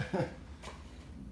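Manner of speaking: short airy self-conscious laugh; breathy laugh. Real, not acted. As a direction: laugh it off softly. Laughs at own rambling.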